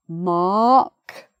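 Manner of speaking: "mark" as a long rising "maahk", R silent. Shown as a demonstration. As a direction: say "mark" the British way, stretched open vowel. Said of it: "no R".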